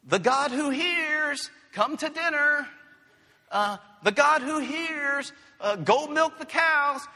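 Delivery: high, loud mock call of a mother to her son; mother calling child. Mother calls her son to dinner, high and loud. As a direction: call across the yard, hammed up and high.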